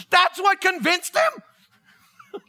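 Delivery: high, slow, incredulous exclamation; mock disbelief. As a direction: draw it out high in comic disbelief. Mock disbelief amid laughter.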